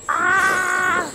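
drawn-out "ah" scream; frightened cry. Real, not acted. Player scared by the game threat, drawn-out frightened cry.

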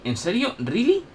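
rising disbelief, pitch jumping up; incredulous double-take; surprised. Real, not acted. Disbelief nobody left.

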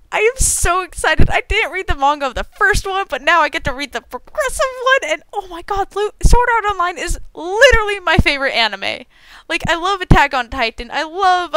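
high, gushing fangirl excitement; bubbling over with excitement; overexcited. Real, not acted. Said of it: "I'm a little overexcited".

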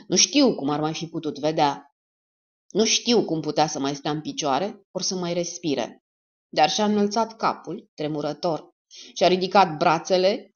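ordinary delivery

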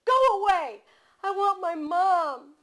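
put-on high, loud wailing child voice; crying child. A narrator's reading. Frightened little girl crying at the stranger for her mom. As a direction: wail like a small girl wanting her mom.

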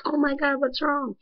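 imitating a worried teacher noticing tears; concerned teacher. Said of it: "my teacher to see and be like".

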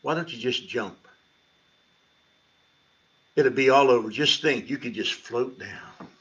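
tempting inner voice. Retold or imitated. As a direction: coax like a quiet voice in his head. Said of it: "this little voice in my head says".

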